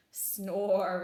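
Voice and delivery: low, loud snoring; snore. A narrator's reading. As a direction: snore it out in deep sleep. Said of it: "replied Bear".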